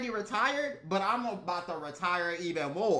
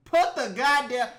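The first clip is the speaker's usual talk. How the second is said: louder, fed-up outburst; louder angry repeat; 4 semitones higher, 8 dB louder than usual. Real, not acted. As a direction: bark it at the cop. Ranting at officers.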